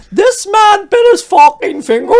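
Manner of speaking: high, loud, slow shouted quote through laughter; laughing mock shout. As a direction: belt it out while cracking up. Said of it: "and it says on it".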